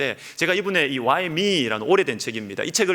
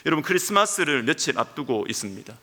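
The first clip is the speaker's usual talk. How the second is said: usual speaking voice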